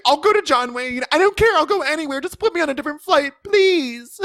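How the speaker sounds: desperate passenger. Retold or imitated. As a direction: beg the airline in mock panic. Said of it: "lost her shit at the terminal".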